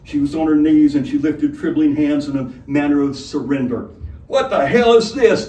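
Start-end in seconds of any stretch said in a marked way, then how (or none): 4.3-5.5 higher, louder indignant demand. Acted. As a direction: snap it out like an outraged gentleman. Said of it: "demanded Sir Straw Hat".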